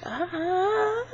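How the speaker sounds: a high, weak, strangled scream; pathetic scream. Retold or imitated. Dream self screaming at attackers, a pathetic high scream. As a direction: scream but barely get it out. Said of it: "like, pathetically screaming".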